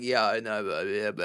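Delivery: low, dropped-down babble; mumbly low-pitched nonsense chatter; old voice. Shown as a demonstration. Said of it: "fall back into my old voice".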